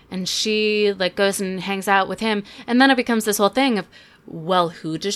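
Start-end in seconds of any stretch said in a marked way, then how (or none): none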